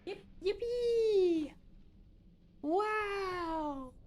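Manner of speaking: very high squeaky puppy talk. Real, not acted. Cooing at the dog on stream.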